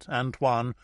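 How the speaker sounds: usual speaking voice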